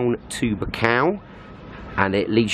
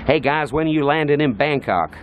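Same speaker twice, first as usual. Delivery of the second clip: mock American accent, pitched higher; 4 semitones higher than usual. Brit imitating Americans asking about landing, mock American accent. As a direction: put on an American voice, stressing BANG in Bangkok. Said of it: "like the Americans would do".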